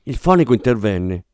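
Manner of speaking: usual speaking voice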